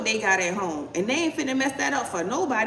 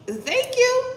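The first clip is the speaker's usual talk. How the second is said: mock voice; 13 semitones higher, 4 dB louder than usual. Mock reply to a question.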